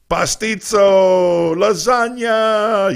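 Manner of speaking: high, loud, very drawn-out food names; hammed-up food cry. Host reading out listeners' favorite foods, hammed-up food cry. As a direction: stretch each dish out in a big high cry.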